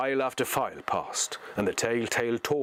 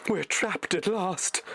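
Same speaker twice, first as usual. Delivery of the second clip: high, slow, defeated hobbit voice; Frodo despairing; 10 semitones higher, slower than usual. Acted. Frodo sinks by the cliff, high slow despair. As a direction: give up, the trap has closed. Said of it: "he said".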